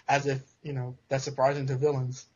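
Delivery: ordinary delivery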